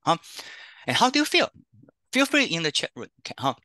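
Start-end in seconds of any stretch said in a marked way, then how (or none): none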